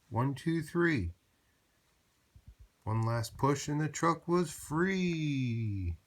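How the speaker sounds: reading a big team push; building count. A narrator's reading. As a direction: count up and heave.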